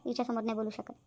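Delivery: low, soft words meant only for her; hushed aside. Acted. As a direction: keep it out of the daughter's hearing. Not in front of daughter. (वडील आईला हळू, दबक्या आवाजात सांगतात)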